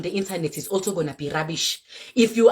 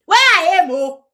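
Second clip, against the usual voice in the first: exclaimed; 9 semitones higher, 8 dB louder, slower than usual. Real, not acted. Repeats phrase, builds up.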